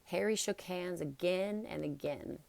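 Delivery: usual speaking voice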